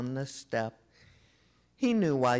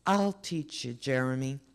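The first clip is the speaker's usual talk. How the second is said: ordinary delivery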